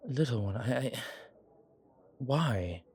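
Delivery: faltering, halting words of disbelief; stunned stammer. Acted. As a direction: stumble over your words, moved and puzzled. Stunned that companion cares.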